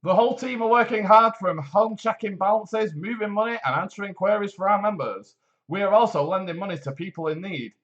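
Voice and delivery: polished advert read, steady and upbeat; voiceover voice. Acted. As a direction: sell it like a radio ad announcer. Reads the credit union advert.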